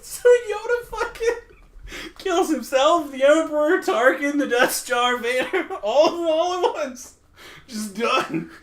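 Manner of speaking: high, laughing through the ending; real laughter at the ending. Real, not acted. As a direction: narrate while laughing.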